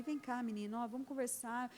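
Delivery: soft, patient call to a pupil; a teacher taking an unruly boy aside to talk; gentle teacher. Retold or imitated. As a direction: coax the boy calmly.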